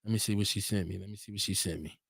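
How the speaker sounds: put-on low, quiet Jamaican accent; joking Jamaican accent to himself. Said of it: "Was that a good Jamaican accent".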